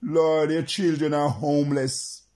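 normal talking voice

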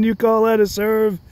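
put-on high, outraged old man yelling at the court; mock tantrum of a grumpy old tennis star; old McEnroe flipping out. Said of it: "He'd be flipping out".

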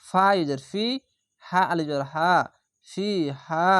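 ordinary talk

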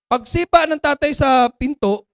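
normal talking voice

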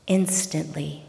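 usual speaking voice

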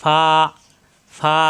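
"ফা" as a soft f; gentle, airy f in "ফা". Shown as a demonstration. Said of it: "নরম করে উচ্চারণ".

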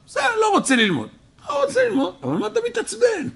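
high-pitched, fast mocking reply; mock exasperation. Rabbi mimicking his answer to the upset student, high mock exasperation. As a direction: toss it back with a teasing, incredulous lilt.

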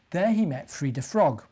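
ordinary delivery, nothing marked

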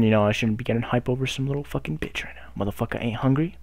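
ordinary talk

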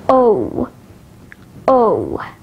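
gliding "o" as in cloak; isolated "o", the vowel of yoke; diphthong demo. Shown as a demonstration. Teacher showing learners diphthongs, "o" as a full glide. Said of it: "at the diphthongs".

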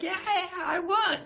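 high, slow, stretched victory whoop; a turtle jumping for joy at the finish; excited cheer. Retold or imitated. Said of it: "he jumps up and down like".

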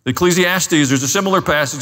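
usual speaking voice

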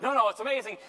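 high and loud, excited protest; eager insistence. Retold or imitated. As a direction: insist with wide-eyed enthusiasm. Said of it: "And he's like".

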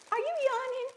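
high, loud teasing disbelief; playful mock outrage. Real, not acted. Wife teasing husband Jared for yawning, high mock shock. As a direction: catch him yawning and tease.

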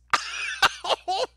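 high, airless wheeze of a laugh; breathless laugh. Real, not acted. Streamer cracking up at the clip, wheezing laugh. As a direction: let a silent wheezing laugh out.

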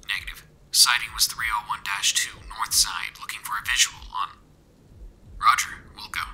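regimented, muffled radio transmission; stern military radio voice. A narrator's reading. Soldier reports a sighting over radio, stern and regimented. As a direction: report crisply over a faint radio link. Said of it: "A stern and regimented voice".

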